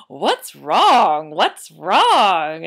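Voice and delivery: loud, sharply swooping question; puzzled baker. Acted. Baker walking into the crying house, loudly puzzled. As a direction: boom it over the crying.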